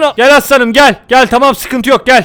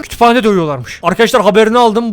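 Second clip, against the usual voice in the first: ordinary talk, nothing marked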